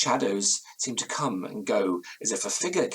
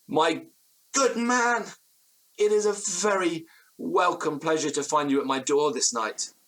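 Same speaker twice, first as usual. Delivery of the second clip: grateful welcome; 7 semitones higher than usual. Acted. Narrator grabs landlord in embrace.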